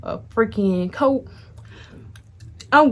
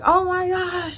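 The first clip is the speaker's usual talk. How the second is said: shocked; 9 semitones higher, slower than usual. Retold or imitated. Reacting to the gun accusation.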